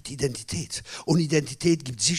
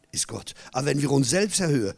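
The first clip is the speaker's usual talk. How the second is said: normal talking voice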